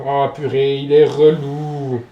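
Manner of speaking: slow, loud annoyed drawl; exasperated groan. Real, not acted. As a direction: drag it out in exasperation. Annoyed at Pokémon fleeing.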